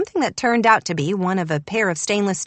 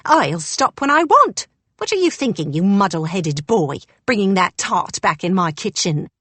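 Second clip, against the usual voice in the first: put-on scolding old housekeeper. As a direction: scold him like a boy.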